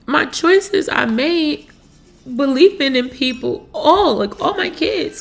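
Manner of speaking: high, loud, pleading voice on the edge of tears; real distress, pitch rising as she pleads; near tears. Real, not acted. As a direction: let the voice climb as the tears come. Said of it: "I just been crying so much".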